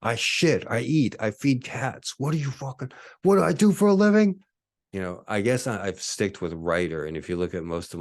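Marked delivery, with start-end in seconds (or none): 0.0-4.4 rising into a squeaky, higher, louder outburst; exasperated mock rant that builds to a high yelp. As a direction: play it like a flustered rant climbing to a shriek. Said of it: "I'm, I'm".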